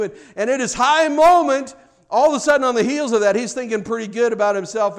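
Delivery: high, loud and quick with building fervour; rising excitement. Real, not acted. Preacher building to a fever pitch to the congregation. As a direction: preach it up to a peak.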